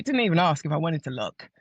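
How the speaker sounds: ordinary talk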